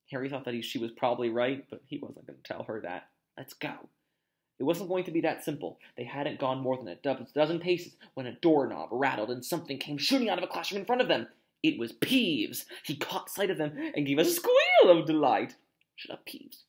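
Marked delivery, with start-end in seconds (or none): 3.4-3.9 whispering. Acted. Sneaking away from Peeves. 16.0-16.6 whispering. Acted. Pleading hushed to avoid discovery.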